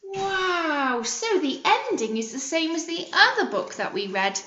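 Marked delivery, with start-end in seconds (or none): none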